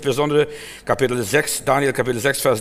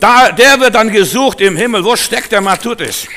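loud, higher call searching for someone; 6 semitones higher, 8 dB louder than usual. Acted. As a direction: call out across heaven for Matthäus. Heaven searching, calling out for him. (laut rufend)

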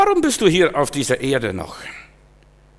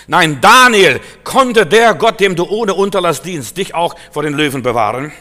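king calling out; 2 semitones higher, 6 dB louder than usual. Acted. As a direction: call down to Daniel in the lions' den. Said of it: "rief nicht". (der König ruft laut in die Grube)

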